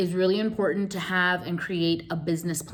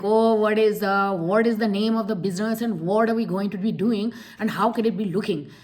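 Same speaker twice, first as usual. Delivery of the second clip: put-on Indian accent; 3 semitones higher, 3 dB louder than usual. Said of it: "same for an Indian".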